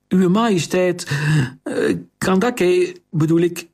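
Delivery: high, halting, nervous correction; flustered servant girl. Acted. Servant girl before the queen, high and nervous. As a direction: stumble over the title, then catch yourself.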